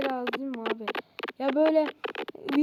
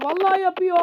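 higher, louder panicked repeat; panicking as the striker gets through; alarmed cry; 4 semitones higher, 6 dB louder than usual. Real, not acted. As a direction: cry it out as the goal comes. Panic rising.

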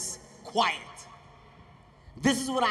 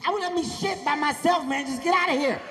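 high, exasperated yell; 6 semitones higher, 4 dB louder than usual. Retold or imitated. Shouting through the stall door at the fan, exasperated. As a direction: shoo him off, fed up. Kevin snapping back at fan.

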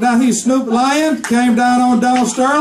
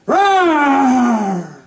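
lion roar. Acted. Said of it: "And he would be like".

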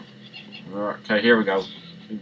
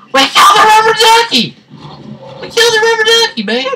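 high shrieking wail over a toy duck; mock outraged shriek; 9 dB louder, faster than usual. Gamer mourns the rubber ducky in a piercing mock-outraged shriek. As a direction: squeal it out, outraged at the loss.

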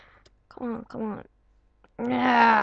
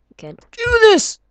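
excited; 12 semitones higher, 6 dB louder, faster than usual. Real, not acted. Urging himself during hard jump.

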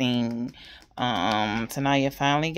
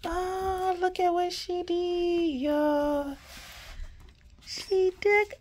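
delighted gush; 13 semitones higher, 8 dB quieter, much slower than usual. Real, not acted. Gushing over pink card.

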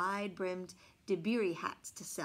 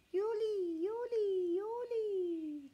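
high creeping sing-song; sing-song yoli; 10 semitones higher, much slower than usual. A narrator's reading. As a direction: creep the word up high like sneaking monkeys. Monkeys creeping down.

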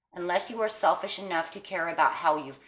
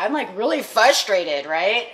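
louder, rising exasperation; feeling someone's frustration build up; real frustration; 3 semitones higher, 8 dB louder than usual. Real, not acted. Reader shares caller's frustration, loud exasperated. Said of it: "this is frustrating me".

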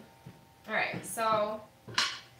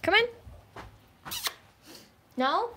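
talking to a dog; 5 semitones higher, 4 dB louder, faster than usual. Real, not acted. Coaxing dog to go outside.

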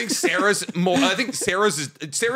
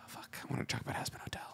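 barely audible, rushed whisper; hushed, hurried grumble; muttered aside; 21 dB quieter, faster than usual. Pretend. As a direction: whisper it quickly under your breath. Disappointed aside under breath.

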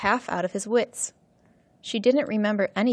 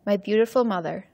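usual speaking voice

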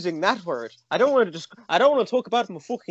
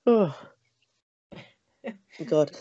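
ordinary delivery

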